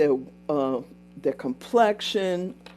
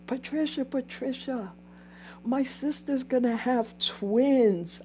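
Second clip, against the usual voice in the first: higher, softer imitation of the coworker; coworker's voice; 7 semitones higher, 4 dB quieter than usual. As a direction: call her name like the coworker. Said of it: "she goes".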